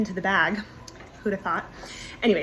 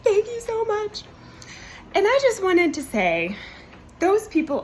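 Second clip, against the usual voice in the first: heartfelt thanks; 11 semitones higher than usual. Retold or imitated. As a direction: pour out relieved gratitude. Thanking pilot at baggage claim.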